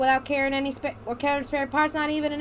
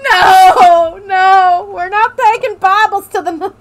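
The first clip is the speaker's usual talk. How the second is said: loud, higher shout through laughter; laughing protest; 6 semitones higher, 14 dB louder, much slower than usual. Real, not acted. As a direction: protest while cracking up. Laughing protest at Bibles joke.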